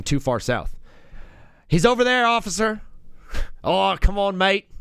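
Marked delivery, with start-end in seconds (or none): 1.7-2.8 raised-pitch pretend tattletale to the police; mock informant. Streamer pretending to report an Australian to police, mock informant. Acted calling the cops on Australian. 3.6-4.7 playful Aussie protest; mock Australian accent. Australian caught by police, mock Australian accent. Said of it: "getting arrested in Australia".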